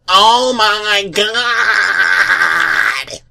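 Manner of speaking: hammed-up drawn-out scream. A narrator's reading. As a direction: stretch it into a long slow wail. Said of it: "And he was screaming".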